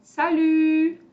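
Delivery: "salut" high and loud, final vowel held with pouted lips; rounded U in salut. Shown as a demonstration. Teacher modelling salut for learners, "salut" ending on a long rounded French u. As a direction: stretch the end of "salut" as an I through rounded lips. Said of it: "U é aquele nosso famoso biquinho".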